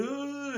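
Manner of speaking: high, strained startled grunt; mock monster panic; panicked monster groan. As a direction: let out a freaked-out creature groan. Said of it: "He's like".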